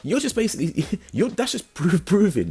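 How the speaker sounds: high-pitched incredulous rant with a laugh. Retold or imitated. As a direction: pitch way up as if his nerve is laughable. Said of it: "And I'm thinking".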